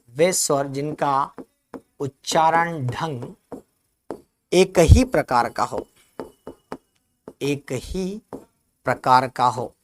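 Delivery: उच्चारण ढंग dictated slowly, word by word; slow dictation. Shown as a demonstration. Teacher dictates a definition to students, slowly. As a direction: read it out so they can write. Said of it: "ऐसा भी लिखना".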